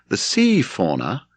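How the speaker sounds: plain reading voice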